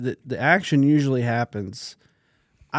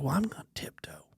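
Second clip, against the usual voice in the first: put-on softer sneaky kid's whisper; 2 semitones higher, 11 dB quieter, faster than usual. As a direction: whisper the tiptoe plan. Son plans sneaking up.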